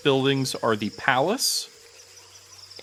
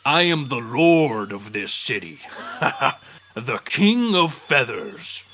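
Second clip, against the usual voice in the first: pompous dinosaur king; 4 dB louder than usual. Acted. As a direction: boast like a lordly tyrant lizard. GM voices the T-Rex king.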